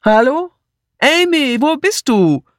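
high, carrying search call. A narrator's reading. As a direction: call through the house for a missing friend. Searching the house for Amy. (laut durchs Haus gerufen)

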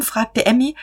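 plain reading voice

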